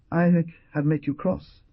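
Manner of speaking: a hint of anguished regret; self-reproach. A narrator's reading. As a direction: beg forgiveness, hurting inside. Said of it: "in an agony of self-reproach".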